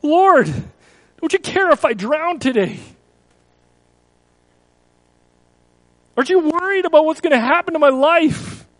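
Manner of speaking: loud, high anguished prayer; a believer crying out to God in a crisis. Retold or imitated. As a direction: plead with the Lord in fear. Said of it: "we cry out".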